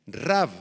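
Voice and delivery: "Rav" said slowly, stress on its A; Aramaic word. Shown as a demonstration. Pastor dictating the word Rav to the congregation, slow. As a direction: stretch the A in "Rav". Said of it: "con acento en la A".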